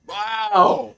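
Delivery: a high astonished wow; amazed wow. Real, not acted. Fan stunned by the big return, amazed high wow. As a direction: let it fly up in disbelief.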